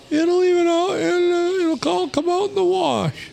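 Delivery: very high breezy boss imitation; mocking boss voice. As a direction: chirp it like a carefree boss. Mocking boss brushing off pay.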